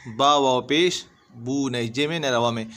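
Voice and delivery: ordinary talk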